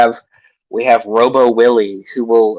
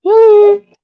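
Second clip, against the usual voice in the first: high, loud call across the room; 5 dB louder than usual. Real, not acted. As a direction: yell his name to find him. Calling out to absent friend.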